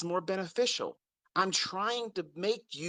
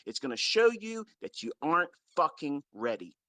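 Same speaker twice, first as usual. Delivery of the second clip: ordinary talk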